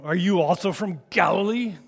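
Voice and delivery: loud sneering question; snarling. Acted. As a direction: snarl it at Nicodemus. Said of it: "you can just picture the snarls here".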